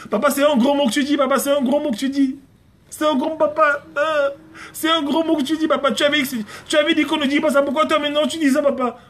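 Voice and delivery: put-on very high child voice, insistent; imitated daughter. Daughter catching papa saying a bad word, high imitated child voice. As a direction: squeak out a little child's repeated reproach. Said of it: "c'est ma fille, ça".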